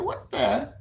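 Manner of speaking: high, soft puzzled what-the; bemused high mutter; taken aback. Retold or imitated. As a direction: caught off guard, lifting the pitch. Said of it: "I said".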